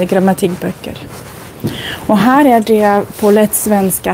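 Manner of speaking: usual speaking voice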